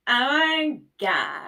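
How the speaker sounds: put-on valley girl voice. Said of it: "that blonde bitch off of".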